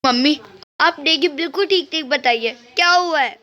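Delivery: a daughter rushing in, anxious for her mother; worried daughter. Acted.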